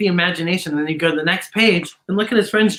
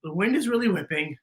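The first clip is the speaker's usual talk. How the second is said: "whipping" said softer and lower, quick; quick aside; 3 semitones lower, 7 dB quieter, faster than usual. Shown as a demonstration. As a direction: tuck it in softly as a reminder. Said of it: "So wait a minute".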